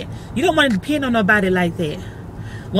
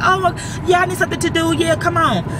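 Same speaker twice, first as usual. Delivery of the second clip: higher, cheerful grandma saying yes; eager grandparent; 7 semitones higher than usual. Pretend. Grandparents agreeing to babysit, voiced higher and eager. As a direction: chirp it like a glad grandmother.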